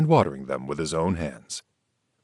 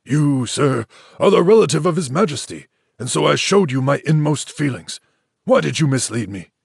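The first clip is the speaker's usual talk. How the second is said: higher, louder, faster accusation; hurt, angry rebuke; indignant reproach; 8 semitones higher, 5 dB louder than usual. A narrator's reading. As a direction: rise up and accuse him. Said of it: "Dong Cheng changed color and rose".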